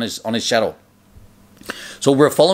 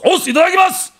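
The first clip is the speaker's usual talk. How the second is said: high, loud karate chorus; group shout; 5 dB louder, faster than usual. Retold or imitated. Students answering the master before eating, high loud shout. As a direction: shout it like a whole table of students. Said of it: "And then everyone goes".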